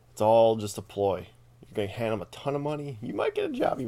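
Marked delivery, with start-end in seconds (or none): 3.0-3.9 sing-song mock promise; mock hopeful job pitch. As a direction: voice jumps way up on might. Mocking pitch of a hopeful line.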